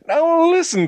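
very high, loud interjection; agitated, high-pitched protest. A narrator's reading. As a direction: burst in to object. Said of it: "protested James".

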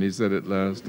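ordinary delivery

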